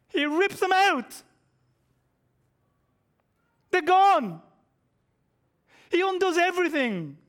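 high, loud, climactic declaration; preacher building to a triumphant peak; loud preaching. Acted. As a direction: proclaim it with full force.

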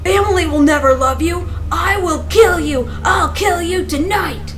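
screamed threats, high and loud; furious high-pitched yelling; fury. A narrator's reading. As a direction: erupt in murderous rage. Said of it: "His fury scorched in silence, then broke".

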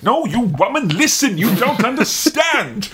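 high, drawn-out singsong put-on voice; mock rant. Man lecturing a woman to listen, high mock rant. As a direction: swoop up high and drag the words out.

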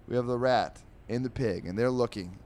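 normal talking voice

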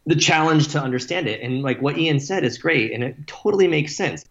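ordinary talk

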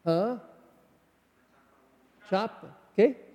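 normal talking voice

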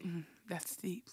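very soft, solemn murmur; hushed reaction. Retold or imitated. Mocking Oprah's show reacting to pain, hushed murmur. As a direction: drop it low in volume, as if moved. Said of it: "They be like".

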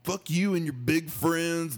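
put-on high, slow sneering drunk voice; high slow mock drunk taunt; mocking drunk boyfriend. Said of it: "He's like".

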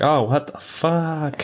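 very high, drawn-out disbelief; stretched, high-pitched imitation of horror; mock shock. Cleaners seeing the bruised bride, mock shock.

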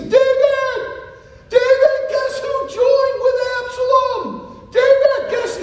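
high, loud, urgent calling of David's name; frantic yelling messenger. Acted. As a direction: shout it across the hillside like breathless news.

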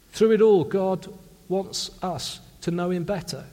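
ordinary delivery

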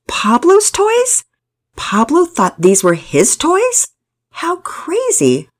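high, drawn-out mock disbelief; amused incredulity. A narrator's reading. Pearson finds Pablo's claim hilarious, amused disbelief. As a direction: marvel slowly at the absurd idea. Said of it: "he also thought it was hilarious".

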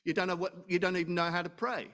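usual speaking voice

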